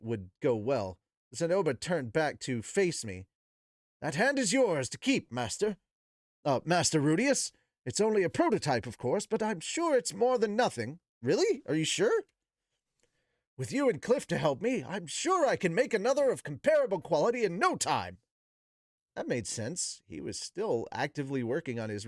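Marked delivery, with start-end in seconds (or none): 4.0-11.0 higher, eager generous voice; earnest Zenoba. Acted. Zenoba giving Rudeus the prototype hand, eager. 11.2-12.2 higher, disbelieving question; a lightly voiced grateful boy; surprised Rudeus. Rudeus doubting he may keep the hand, surprised. 13.6-18.2 higher, assured reply; a lightly voiced proud maker; confident Zenoba. Zenoba reassuring Rudeus about another hand, confident.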